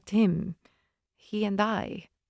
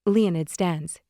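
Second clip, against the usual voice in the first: usual speaking voice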